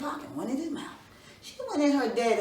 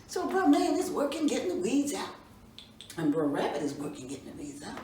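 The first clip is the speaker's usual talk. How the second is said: ordinary delivery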